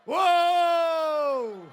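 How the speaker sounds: long, drawn-out stadium whoop; caster's long shout. Acted. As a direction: stretch the wow out like a caster. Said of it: "야구 캐스터처럼". (길게 뽑는 중계 환호)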